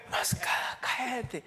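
hushed whisper to himself. Pretend. Preacher hushes himself in a whisper about the scarf. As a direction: whisper it like a secret.

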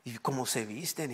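usual speaking voice

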